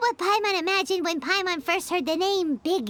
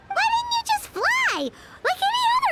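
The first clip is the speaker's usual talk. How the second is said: exasperated shout; 11 semitones higher than usual. Acted. Paimon asks the lost ghost why he didn't fly, shouting. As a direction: throw up your hands in disbelief.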